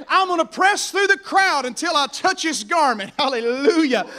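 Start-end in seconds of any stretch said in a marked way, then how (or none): 0.1-3.1 loud, high preacher's cry; determined declaration. Acted. Preacher voices the woman pressing through the crowd, determined. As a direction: declare it forcefully, pitched high.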